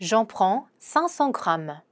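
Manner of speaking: very slow, careful French; slow pronunciation model; slow demo. Shown as a demonstration. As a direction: lay out each syllable slowly for learners. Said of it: "pronunciation demo".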